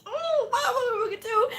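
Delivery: very high squeaky wail; hammed-up helpless squeal; mock panic. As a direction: squeak it out in acted despair. Retold exam panic.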